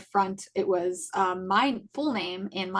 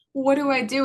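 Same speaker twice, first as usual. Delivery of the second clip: high, loud, panicked plea for help; 6 semitones higher, 6 dB louder than usual. Retold or imitated. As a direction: cry out what do I do, alarmed. Panicked question to a friend.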